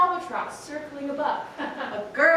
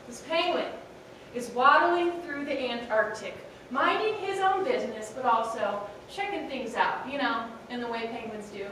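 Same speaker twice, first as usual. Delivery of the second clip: ordinary delivery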